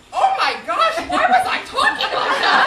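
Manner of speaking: loud, high mock dismay; imitating a horrified husband, half laughing; appalled realization. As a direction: cry out as if aghast at yourself. Acted dismay at own recorded accent.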